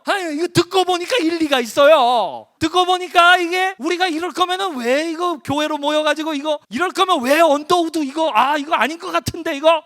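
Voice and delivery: high, loud, indignant mock complaint; acted grumbling. Preacher imitating ordinary churchgoers agreeing with complaints, high loud grumbling. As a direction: voice a gossip swept up in grievance. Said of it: "평범한 사람들은 어떻게 하냐면요".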